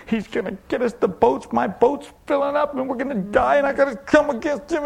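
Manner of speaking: high, breathless-sounding run of worry; fearful panic. Acted. Preacher voices believers afraid the boat will sink, fearful panic. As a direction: mimic someone praying out of fear. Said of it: "We've got a fear in our heart".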